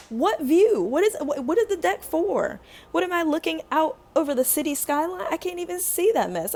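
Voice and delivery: high, exasperated comic rant; animated, swooping outrage at the absurdity; incredulous. Real, not acted. Mocking deck with blocked view.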